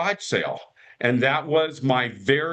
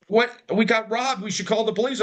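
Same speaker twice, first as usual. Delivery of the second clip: high-pitched retold alarm; mock alarm, pitched well up; alarmed dad; 8 semitones higher, faster than usual. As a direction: act out a startled father. Voicing his alarmed dad.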